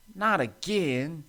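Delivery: high, drawn-out exclamation; dismayed cry. A narrator's reading. Stanley derailing another time, dismayed cry. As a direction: cry it out slowly, exasperated. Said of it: "he cried again".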